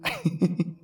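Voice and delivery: put-on light, airy child's giggle; soft giggle. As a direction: giggle as if on a swing. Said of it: "flew into the air giggling".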